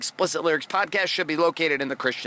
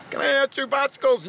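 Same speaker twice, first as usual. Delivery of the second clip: put-on kid begging; 9 semitones higher than usual.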